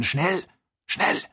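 urgent. A narrator's reading. Kennedy urging Joe to run, urgent. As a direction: urge him on, each word punched.

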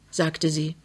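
ordinary delivery, nothing marked

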